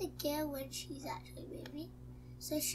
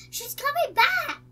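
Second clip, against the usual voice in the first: high, loud panicky warning; alarmed cry; 12 semitones higher, 13 dB louder than usual. Real, not acted. Watcher warns player that she's coming back, alarmed cry. Warns that creepy figure is returning.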